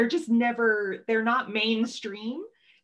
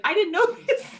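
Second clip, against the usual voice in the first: high, louder, laughing delight; excited partner; 13 semitones higher, 4 dB louder than usual. Retold or imitated. Partner at the menorah lighting, mimicked as high and laughing. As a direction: mimic his giddy surprise with a laugh. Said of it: "And he's like".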